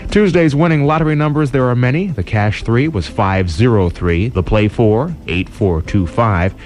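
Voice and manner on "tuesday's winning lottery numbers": normal talking voice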